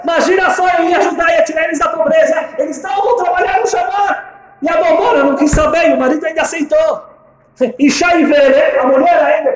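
high, loud, amused telling; laughing retelling. Retold or imitated. Husband laughs telling his wife, high and loud. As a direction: laugh through the whole story.

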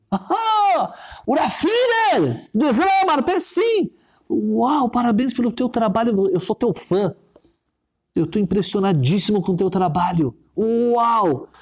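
high-pitched, gushing fan exclamations; starstruck excitement. Acted. Stranger recognizing the famous rabbi he admires, starstruck excitement. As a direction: burst out in amazed, stretched high cries.

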